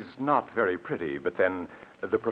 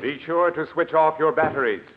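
higher and louder, called after him; calling out; 9 semitones higher, 7 dB louder than usual. Acted. Assistant calling after the robot as it leaves, raised voice. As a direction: call out to someone leaving the room.

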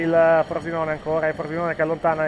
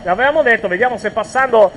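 raised, excited play-by-play; breathless italian goal commentary; 6 semitones higher, 6 dB louder than usual. Real, not acted. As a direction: keep the goal energy up, louder. Commentary right after the goal.

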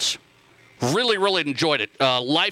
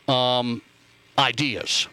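ordinary delivery

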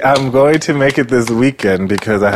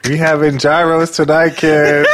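calling out; 7 semitones higher than usual. Pretend. Calling out to family.

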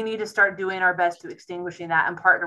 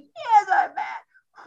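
put-on high shouted kid voice, far higher; mad child; 10 semitones higher, much slower than usual. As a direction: play the angry little kid yelling back.

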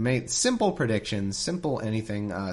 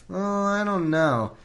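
mock hesitant Moses; 7 semitones higher, 3 dB louder, slower than usual.

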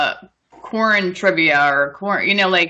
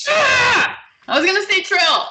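surprised; 11 semitones higher than usual. Real, not acted. Rival steals her answer.

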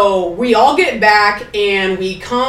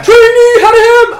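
slow, high, loud Louisiana bark of Trainee Hadney; Louisiana accent, louder; 14 semitones higher, 10 dB louder, slower than usual. Retold or imitated. Instructor yelling her name again, Louisiana accent. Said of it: "He had this thick ax-, like, accent".

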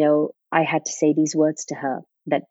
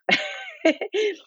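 high laugh; 3 dB quieter than usual. Real, not acted. Laughs after retold line.

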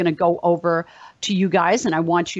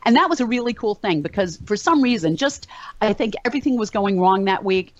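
usual speaking voice

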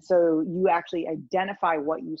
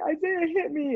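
put-on high, aggrieved child voice; tattling little girl; 10 semitones higher than usual. Little girl reports the boy to his mom, high tattling child voice. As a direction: tell on him like a hurt kid.

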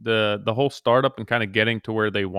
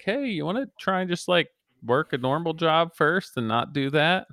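worried parent; 8 semitones higher than usual. Pretend. Parent voiced talking to daughter.

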